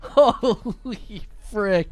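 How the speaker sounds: slow, helpless laughing exclamation. Real, not acted. Streamer cracking up at chat jokes, laughing. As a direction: drag it out through real laughter.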